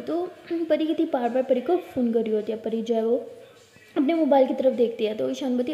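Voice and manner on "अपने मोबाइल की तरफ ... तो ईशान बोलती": usual speaking voice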